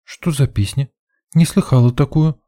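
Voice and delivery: put-on high, light girlish voice; curious girl. A narrator's reading. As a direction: ask with interest, pitched well up. Girl curious about the song. (с любопытством, высоким девичьим голосом)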